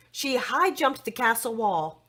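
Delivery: plain reading voice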